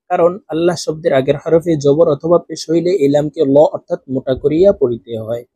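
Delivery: ordinary talk, nothing marked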